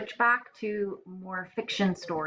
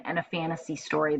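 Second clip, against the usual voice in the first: ordinary delivery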